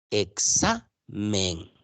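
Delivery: "Examen" very slow, split as e-xa, then men; teacher's slow, separated "Examen"; syllable by syllable. Shown as a demonstration. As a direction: draw out "Examen" with a gap before the final "men". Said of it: "Hay tres sílabas".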